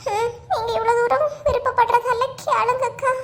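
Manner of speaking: high, sniffly mock reluctance; fake tearful consent. Gita gives in to the insistent neighbour, fake tearful consent. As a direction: give in through put-on tears. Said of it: "என்னமா நடிப்பு நடிக்கலுவ".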